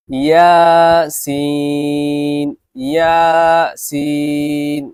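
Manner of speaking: each letter name drawn out slowly and loud; letter drill. Shown as a demonstration. Teacher models letter pairs for students to repeat, drawn out. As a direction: sing out জিম and দাল long and clear. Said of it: "আমি দুবার পড়ব".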